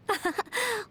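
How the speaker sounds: a short, bright little giggle; light giggle. Acted. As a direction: giggle at your own joke. Laughs at her own pun.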